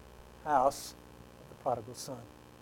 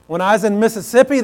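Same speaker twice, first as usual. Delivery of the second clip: ordinary talk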